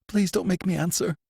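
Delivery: high, fearful begging; pleading. A narrator's reading. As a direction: beg not to be asked. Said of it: "pleaded the prisoner".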